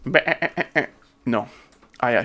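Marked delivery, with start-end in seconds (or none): 0.1-0.9 imitated bleat. Shown as a demonstration. Imitates animal after mule question.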